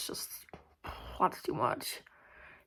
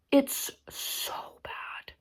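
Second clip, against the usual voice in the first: slow, breathy whisper of dread; whispered disgust; 4 semitones higher, 7 dB quieter, much slower than usual. Real, not acted. To the viewers about the awful movie, a slow whisper. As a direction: hush it like it still haunts you.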